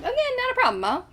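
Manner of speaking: high, loud squeaky mimic of Mo; mocking Mo. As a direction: squeak it out like a snippy character. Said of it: "Mo gonna, like".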